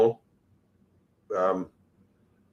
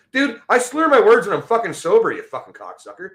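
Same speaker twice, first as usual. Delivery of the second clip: angry yelling; 9 semitones higher than usual. Real, not acted. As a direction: shout him down. Yelling back at the troll.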